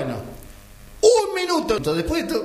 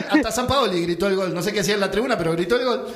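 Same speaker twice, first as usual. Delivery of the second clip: ordinary talk, nothing marked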